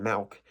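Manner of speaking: "milk" said as "malk". Shown as a demonstration. Conceding the A is heard, "milk" mispronounced as "malk". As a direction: give "milk" an A sound in place of the I. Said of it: "there's an A in milk".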